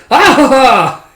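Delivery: high, loud mock triumphant laugh; gleeful laugh. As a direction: laugh like a gleeful little maniac. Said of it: "and she goes".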